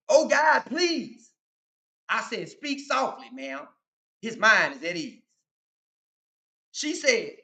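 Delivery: rhythmic street poem delivery; toast recitation. Acted. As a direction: perform it like an old toast.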